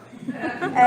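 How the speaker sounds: a quick real laugh; short laugh. Real, not acted. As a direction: laugh at the memory. Laughs at her own story.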